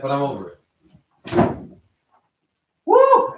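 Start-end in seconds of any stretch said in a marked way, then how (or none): 2.8-3.3 sudden high loud venting whoop; angry whoop. Real, not acted. Streamer furious at diabetes numbers, high loud whoop. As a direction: let the frustration burst out in a yell.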